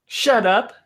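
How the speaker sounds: playful shut up. Acted. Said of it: "she's like, Shut up again".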